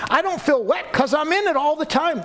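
high, indignant talking fish; a fish who finds the question absurd; silly fish voice. Pretend. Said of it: "the fish would go".

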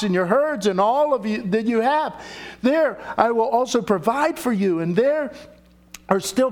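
high, declaiming read of Joseph's words; scripture reading. Acted. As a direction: read it out as Joseph pleading with his brothers. Reads Joseph scene aloud.

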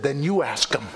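put-on low, deadpan old lady's comeback; old saintly lady. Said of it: "the old saintly lady".

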